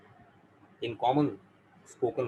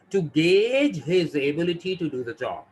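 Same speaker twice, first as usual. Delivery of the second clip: slow example read; 4 semitones higher, 4 dB louder, much slower than usual. Shown as a demonstration. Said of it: "reading example slowly".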